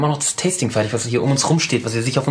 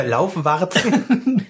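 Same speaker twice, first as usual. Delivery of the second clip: laughing; 6 semitones higher, much slower than usual. Real, not acted. Laughing while quoting nickname.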